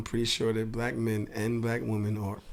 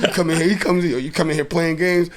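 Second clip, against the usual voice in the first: mock rant; 5 semitones higher, 5 dB louder than usual. Ranting at security guard.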